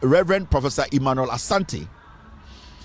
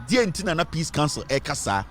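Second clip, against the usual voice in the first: normal talking voice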